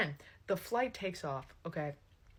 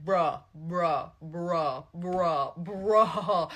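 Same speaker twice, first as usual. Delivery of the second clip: louder annoyed bruhs; grumbling at the jacket; mock frustrated groans; 3 semitones lower, 5 dB louder, much slower than usual. Husband fighting his jacket, annoyed bruhs.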